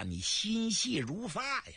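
ordinary delivery

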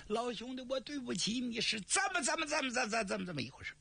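high tearful stammer fading to nothing; crying, stammering. Acted. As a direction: sob out the apology, stuck on the words. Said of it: "叫他这一哭". (哭着结巴)

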